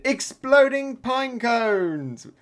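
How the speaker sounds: high, loud, slowly stretched announcement; attack call. Acted. Usopp's pine cones blowing up, shouted attack name. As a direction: draw out the move's name as a cry.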